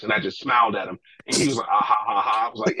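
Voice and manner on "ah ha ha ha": spoken mock "ha ha" laugh; deliberate, put-on ha-ha; imitated laugh. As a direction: mimic someone's chuckle in separate syllables.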